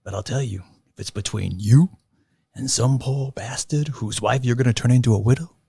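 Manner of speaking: tough, intense gangster talk; movie scene reenactment. Retold or imitated. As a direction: play it like a hard-nosed cop. Reenacting De Niro/Pacino diner scene.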